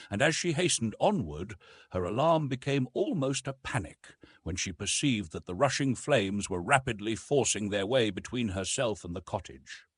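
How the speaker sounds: plain reading voice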